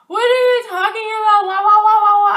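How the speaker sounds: high, loud whiny mock yell with wah wahs; mock his yelling like a crying baby; mocking the CEO. Mimicking her CEO shouting at her, high and whiny. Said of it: "my C E O was like".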